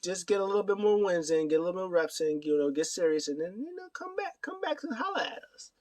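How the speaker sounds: high, climbing imitation of advice; mock encouragement. Imitating Danielson advising Yuta, high mock encouragement.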